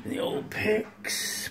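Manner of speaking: half-whispered afterthought, slowed right down; whispered repeat. Real, not acted. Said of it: "I have to look in the old photos".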